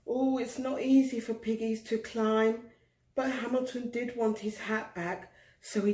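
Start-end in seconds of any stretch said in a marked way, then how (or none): none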